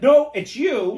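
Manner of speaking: loud, high retort; mock outburst. Fed up with the phone agent, loud mock outburst. What she wants to tell caller.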